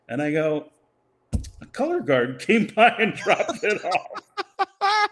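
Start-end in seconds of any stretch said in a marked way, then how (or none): 1.6-5.1 higher, slow, laughing mid-line; laughing through it. Real, not acted. Telling the boss about the box, laughing. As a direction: barely keep it together. Retelling his reply, cracking up.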